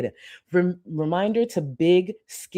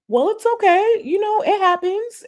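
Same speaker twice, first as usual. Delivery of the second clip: very high, louder self-soothing act; exaggerated high-pitched reassurance; mock consolation; 4 dB louder than usual. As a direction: brush it off in a squeaky, put-on high voice. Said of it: "I'm just like".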